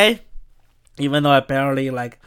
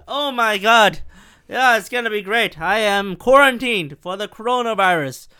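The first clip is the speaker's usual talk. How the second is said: ordinary talk, nothing marked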